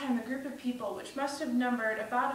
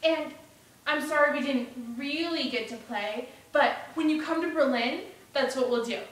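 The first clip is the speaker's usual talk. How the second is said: put-on louder, lifted boyish voice; eager young Bruno; 3 semitones higher, 5 dB louder than usual. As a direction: promise plans to a friend. Voices Bruno making plans.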